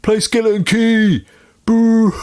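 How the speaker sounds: high, loud booing heckler voice; heckling shout. Retold or imitated. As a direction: bellow from the crowd for a song. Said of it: "and they go".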